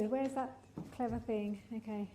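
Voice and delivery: ordinary delivery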